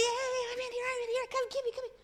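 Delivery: a lightly acted child calling out to her cousin; mock yell. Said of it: "And I'm yelling".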